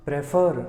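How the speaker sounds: "prefer" with the push on FER; pre-FER, second half stressed; stress on last syllable. Shown as a demonstration. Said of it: "आखिरी वाले syllable पे जोर जा रहा है".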